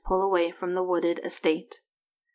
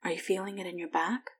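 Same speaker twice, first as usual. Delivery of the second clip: soft, much quieter concerned question; asking quietly; 7 dB quieter, faster than usual. A narrator's reading. Husband quietly asks his wife about back pain. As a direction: lean in and ask gently. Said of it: "he asks quietly".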